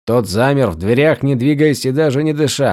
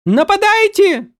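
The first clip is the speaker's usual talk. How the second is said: shrieked order; slower than usual. A narrator's reading. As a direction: scream the command in panic. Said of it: "вскрикнула Вивена". (пронзительный крик)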